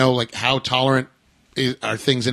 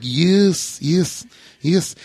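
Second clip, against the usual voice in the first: "Yes, yes, yes" in a mock New Zealand accent; playful New Zealand accent on "yes"; 4 semitones higher, much slower than usual. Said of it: "I know they say yes, like".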